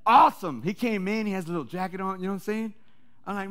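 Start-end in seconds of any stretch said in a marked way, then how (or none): 0.1-0.6 "Awesome" thrown out loud and high; cheering "Awesome" louder the second time. Retold or imitated. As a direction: repeat "Awesome" bigger and louder. Cheering the ex-prisoner's news.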